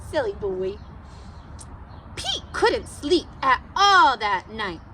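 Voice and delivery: ordinary delivery, nothing marked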